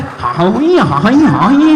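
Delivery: high, loud teasing call; loud sing-song hoot; mock cheer. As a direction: whoop it playfully at the crowd. Teasing the noisy side of the audience.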